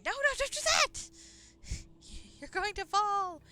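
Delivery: panicked high shrieking mom; frantic mother. Retold or imitated. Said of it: "my mother would be frantic in the background going".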